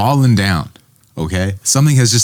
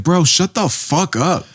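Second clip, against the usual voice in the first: exasperated retort; 8 semitones higher, slower than usual. Retold or imitated. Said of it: "it's like".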